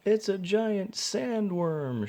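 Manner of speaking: high, slow, terrified shriek. A narrator's reading. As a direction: shriek in horror. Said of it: "shrieked another".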